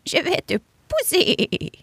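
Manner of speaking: high, slow goat voice; a goat bleating her offer; goat offering help. Acted. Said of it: "proposa-t-elle".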